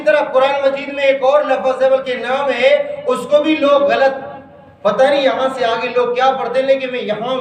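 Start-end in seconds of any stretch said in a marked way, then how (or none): none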